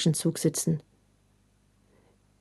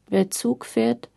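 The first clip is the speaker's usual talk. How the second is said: slow, higher-pitched station announcement; croaky loudspeaker announcer; 5 semitones higher, slower than usual. A narrator's reading. Unclear man's voice over the train loudspeaker, croaking announcement. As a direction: drag the words out like an indistinct tannoy voice. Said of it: "undeutliche Männerstimme aus dem Lautsprecher krächzen".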